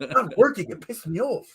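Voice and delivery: high mock German accent; attempted German accent. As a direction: imitate a German friend's complaint in a bad accent. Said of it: "I can't talk like a German person".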